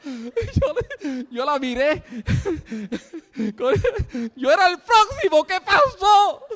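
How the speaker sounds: high-pitched, helpless laughter bursting through the words; laughing hard. Real, not acted. Preacher retelling his turn to the congregation, laughing hard. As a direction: squeal it through real laughter. Breaks up telling his reaction.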